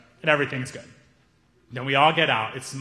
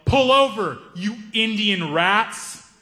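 angry yell; 5 semitones higher, much slower than usual. Retold or imitated. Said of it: "he yelled".

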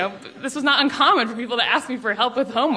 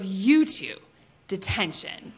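low, quiet, final verdict; understated teacherly sentence with a pause; stern teacher; 4 semitones lower, 4 dB quieter, slower than usual. Retold or imitated. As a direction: hand down punishment softly, pitched low. Teacher mom sentences them.

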